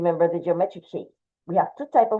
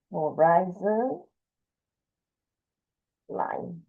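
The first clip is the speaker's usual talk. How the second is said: slow dictation; 5 dB quieter, much slower than usual. Shown as a demonstration. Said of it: "I'm going to put the name here".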